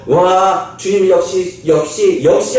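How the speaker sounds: rising, delighted exclamations; excited praise. Acted. Onlookers praising the Lord as they set out, delighted. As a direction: cheer as if amazed.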